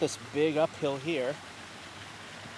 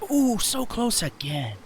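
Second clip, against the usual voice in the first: excited; 8 semitones higher, 5 dB louder, slower than usual. Real, not acted. Another near miss.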